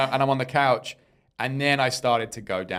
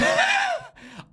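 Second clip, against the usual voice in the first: very high-pitched burst of laughter. Real, not acted. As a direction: laugh high at being caught out. Caught out as a fan.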